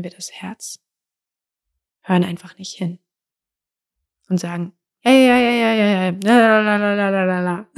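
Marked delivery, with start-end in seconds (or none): 5.0-7.7 higher, louder singsong ja ja la la; la-la ignoring. Pretend. Person mockingly ignoring their heart with singsong. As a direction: drown it out like a kid not listening.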